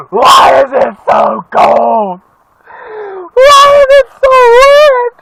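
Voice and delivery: loud, slow fake wail; fake-sob to the sky like a wronged child; mock crying shout. Vlogger fake-wailing about the rain for viewers, then "just joking". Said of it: "Just joking".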